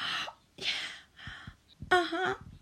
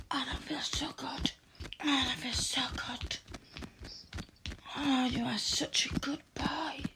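whispering; 3 semitones higher than usual. Acted. Held whispered intimate roleplay.

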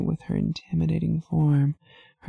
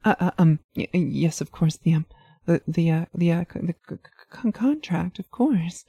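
nervous stammer with broken starts; stuttering; 2 semitones higher, faster than usual. Acted. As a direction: trip over every word nervously. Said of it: "You stutter finally".

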